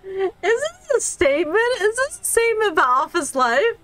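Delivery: high, laughing question; asking through a laugh, genuinely tickled; amused disbelief. Real, not acted. Amused at office game scene.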